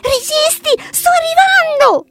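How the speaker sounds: loud high yell; shouting. A narrator's reading. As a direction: bear calls across the lake to the fox. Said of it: "urlò Luke".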